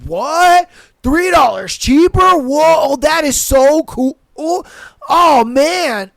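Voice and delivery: loud, high mock wonder; sarcastic amazement. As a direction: gush with fake amazement. Sarcastic fake excitement.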